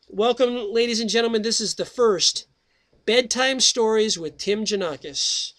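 slowed, announcer-style welcome to the listeners; radio host welcome. Acted. Radio host welcomes listeners to his bedtime story show, announcer style. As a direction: roll out the show name like a host.

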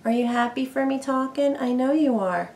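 cooing to pet. Real, not acted. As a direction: coo softly to your parrot. Cooing to her bird.

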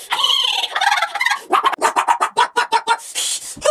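high, long stuttering wail; hammed-up cutesy sobbing; cute fake crying. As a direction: cry showily to be noticed. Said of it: "Cries loudly and cutely".

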